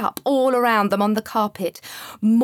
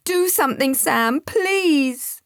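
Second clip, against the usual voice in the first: high, louder desperate plea; pleading; 6 semitones higher, 4 dB louder, slower than usual. Acted. Panicked boy begging his guide, desperate. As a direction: beg Sam for help.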